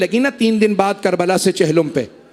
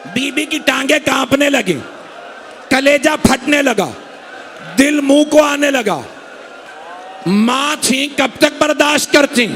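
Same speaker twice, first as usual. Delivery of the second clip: impassioned majlis preaching; 4 semitones higher than usual. Acted. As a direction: build the grief higher with each line. Preacher building the lament.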